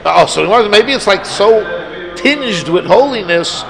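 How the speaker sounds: ordinary talk, nothing marked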